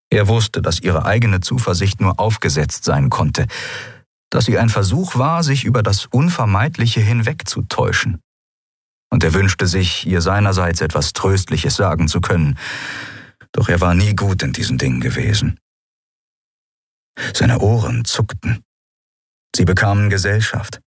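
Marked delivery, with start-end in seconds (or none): none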